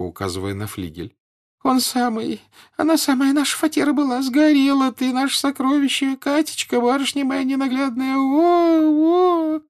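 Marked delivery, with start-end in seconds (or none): 1.6-9.6 high keening peasant wail; howling lament. A narrator's reading. Aniska shows her feelings at the fire, howling. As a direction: wail the words out, ending in long moans. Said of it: "завыла Аниска при виде пожара".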